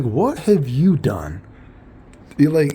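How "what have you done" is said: slow, drawn-out sarcastic question; slow sarcastic retort; mock challenge. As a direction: stretch it like a pointed dare. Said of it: "and it's like".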